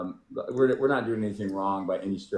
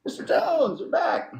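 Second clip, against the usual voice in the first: excited greeting; slower than usual. Real, not acted. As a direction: shout a happy welcome back. Greeting a returning viewer loudly.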